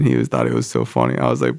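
ordinary delivery

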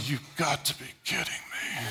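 very soft, low grumble; acted murmur of disbelief; muttered under breath. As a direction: complain privately, barely audible. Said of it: "We talk under our breath".

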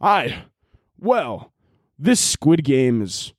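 slow, stilted British overdub; mock British monotone. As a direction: deliver it as a plodding, unemotional British dub. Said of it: "Okay, they're not British".

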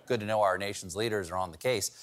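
normal talking voice

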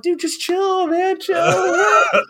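very high, cartoonish chill-out plea; mock squeaky brush-off. Brushing off friend's hype, mock.

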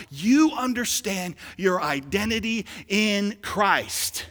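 loud, high, driving sermon shout; full-force preaching. Real, not acted. Preacher telling church they are God's children, shouted preaching. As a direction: preach it hard, punching key words.

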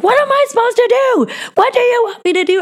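high, loud, desperate cry, pitched far up; retold desperate plea. Replaying her answer to her husband's demand to quit, high desperate cry. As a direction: fling it back at him, overwhelmed and pleading. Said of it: "And I would be like".

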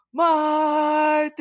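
long, loud, high drawn-out yell of the name; heckling shout. Retold or imitated. Fan heckling the visiting left fielder, drawn-out high yell. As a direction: bellow it from the stands. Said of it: "And I would go".

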